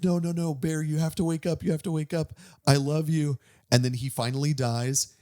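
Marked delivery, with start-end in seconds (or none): 0.0-3.4 a higher mock sob of pleading; lightly acted crying plea, higher; imitating her crying plea. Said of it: "she's crying ... she's like".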